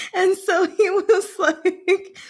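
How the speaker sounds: high, slow, laughing. Real, not acted. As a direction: giggle through it. Laughing retelling.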